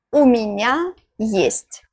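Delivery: normal talking voice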